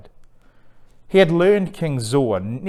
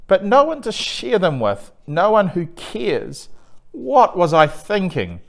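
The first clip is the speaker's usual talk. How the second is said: high, pleading lament, slowing on no one who cares; despairing; 7 semitones higher than usual. Acted. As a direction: ask it in despair, near tears. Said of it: "he asked in despair".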